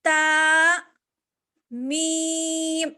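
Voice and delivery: نون and میم hummed long through the nose; nasalized ghunna. Shown as a demonstration. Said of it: "تا الگ ہے اور میم الگ ہے".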